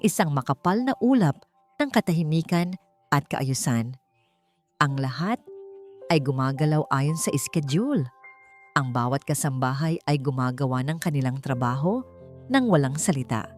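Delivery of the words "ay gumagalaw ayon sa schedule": ordinary delivery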